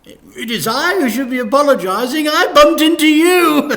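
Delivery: high, loud, over-eager vicar voice; high loud cheery vicar; gushing vicar. Acted. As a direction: beam it out like a toothy vicar. Vicar's voice in story.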